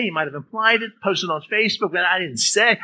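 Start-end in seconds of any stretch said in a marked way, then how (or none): 2.0-2.8 "say" punched and lifted; stress on SAY. Shown as a demonstration. Teacher showing a shift of meaning, stress on "say". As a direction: hit the word "say". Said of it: "change it all around by what we emphasize".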